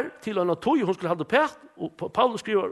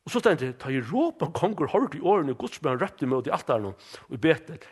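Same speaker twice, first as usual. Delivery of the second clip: usual speaking voice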